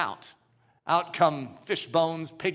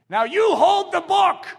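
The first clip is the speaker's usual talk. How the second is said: slow, much higher, much louder command; commanding shout; 12 semitones higher, 8 dB louder, slower than usual. Acted. Gautama orders the witch doctor to hold the book, slow and loud. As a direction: order him, slowly and loud.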